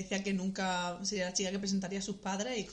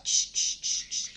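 scissor snips; 5 dB louder, much slower than usual. Pretend. Imitating censor scissors.

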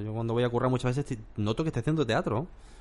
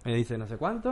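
normal talking voice

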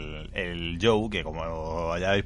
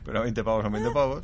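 laughing. Acted. Punchline quote, laughing.

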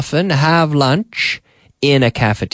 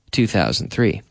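ordinary delivery

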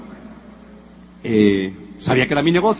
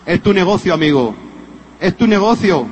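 louder, emphatic sales-pitch preaching; motivational preaching; 6 semitones higher, 4 dB louder than usual. Acted. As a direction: drive the message home to the audience. Motivational push to audience.

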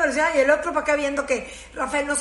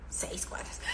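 soft breathed repeat, full of doubt; whispered disbelieving echo from the neighbor; skeptical whisper; 17 dB quieter, slower than usual. Retold or imitated. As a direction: echo it under your breath, unconvinced.

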